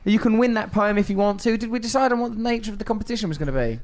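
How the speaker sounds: high, loud cheering host; excited. Real, not acted. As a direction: burst out with delight. Host cheering after poem.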